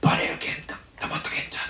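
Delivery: urgent unvoiced whisper; frightened whisper. Disciple hushing a friend in hiding, whispered. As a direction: hiss it so no one outside hears. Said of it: "声もあんまり音立てんようにして".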